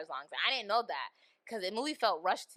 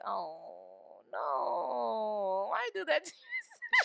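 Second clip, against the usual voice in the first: mock dismay; much slower than usual. Mocking the mom's tattoo reaction.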